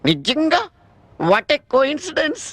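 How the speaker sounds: very high-pitched, drawn-out surprise; exaggerated amazement. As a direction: stretch it out in high, theatrical astonishment. Producer acts amazed at the coincidence.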